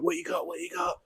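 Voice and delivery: put-on high, excited kid voice asking what you got; eager little boy. Youngest boy running down to his dad, high and eager. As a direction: play the youngest boy bursting with curiosity.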